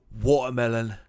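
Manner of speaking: emphatic British "watermelon"; British accent. Shown as a demonstration.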